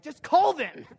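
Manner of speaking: annoyed spouse. Retold or imitated. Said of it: "the spouses who are like".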